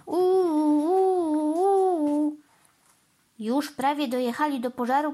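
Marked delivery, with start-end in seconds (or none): 0.1-2.4 loud, high imitated fire engine siren; siren wail. A narrator's reading. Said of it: "syrena wyła bez przerwy".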